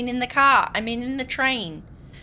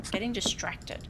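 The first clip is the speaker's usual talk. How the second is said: whisper; 2 semitones lower, 7 dB quieter than usual. Real, not acted. Muttering aside to self.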